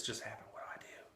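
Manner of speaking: whispered, anxious mother on the phone. Retold or imitated. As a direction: hush it like a panicked caller. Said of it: "she would call me every five minutes".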